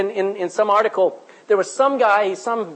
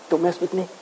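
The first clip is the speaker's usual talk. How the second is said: ordinary talk, nothing marked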